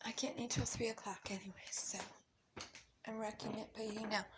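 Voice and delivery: guilty whisper while snacking; whispered aside. Real, not acted. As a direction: confess it under your breath. Aside while tasting food.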